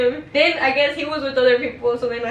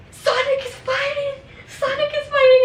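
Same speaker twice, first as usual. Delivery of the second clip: excited yell; 14 semitones higher, 4 dB louder than usual. Retold or imitated. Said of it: "he's like".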